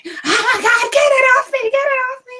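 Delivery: high, frantic get it off me; panicked shriek. Retold or imitated. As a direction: shriek like a spider's on you. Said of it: "I was just like".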